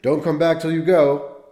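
father yelling after his departing son; yelling after him. Retold or imitated. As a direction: shout it down the street at him. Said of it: "he yelled after me".

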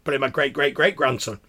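high, loud scandalised protest; indignant shriek. Acted. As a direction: shriek it out, affronted old crone. Crone protests against being called predator.

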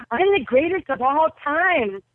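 proud, punchy declaration; brash boast. Retold or imitated. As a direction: burst out cocky, imitating a younger self. Retold boast to Muhammad Ali.

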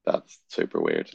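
low, slow, deadpan coach voice; flatly baffled, low and slow; deadpan coaches. Retold or imitated. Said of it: "the coaches were like".

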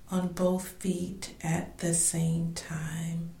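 slow, soft meditation voice with drawn-out breath counts; guided meditation. Real, not acted. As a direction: guide the listener gently through breathing, letting the counts fade softly. Leads a body scan meditation.

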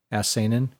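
usual speaking voice

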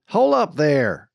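drawn-out loud hail; calling out. A narrator's reading. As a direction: holler to stop a driver. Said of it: "cried Jane".

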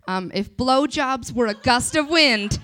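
ordinary talk

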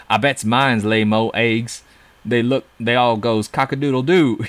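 put-on Southern dialect; Southern accent. Podcaster quoting the comic's villagers, mock Southern accent. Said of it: "I just said that they were Southern".

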